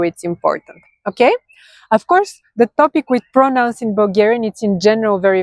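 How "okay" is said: ordinary talk, nothing marked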